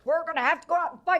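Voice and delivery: high, quick rallying line; hammed-up, rushed call to fight; mock battle cry. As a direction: imitate a captain rousing his men. Retold captain rallying his men.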